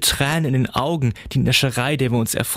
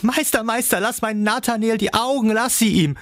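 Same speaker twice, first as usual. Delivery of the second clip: much higher, desperate begging cry; pleading father; 11 semitones higher than usual. A narrator's reading. The father begs Coppelius to spare his son, high pleading cry. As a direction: beg for your son's eyes. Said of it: "flehend die Hände empor und rief".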